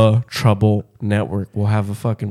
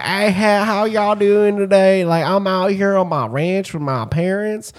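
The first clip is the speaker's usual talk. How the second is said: put-on high-pitched Midwestern farm kid; mock Midwest kid; 9 semitones higher, slower than usual. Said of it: "some little shithead kid from the Midwest".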